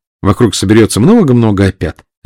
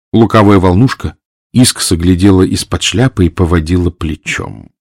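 ordinary delivery

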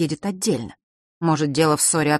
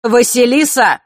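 a loud call, much higher; 8 semitones higher, 8 dB louder, slower than usual. A narrator's reading. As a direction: call her across the hall. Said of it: "вдруг окликнул Нортон-старший".

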